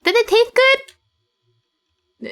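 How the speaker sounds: high, very slow sweet question; girly Yua voice. Acted. Voicing Yua asking if it tasted good, high and slow. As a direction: coo it like a doll asking.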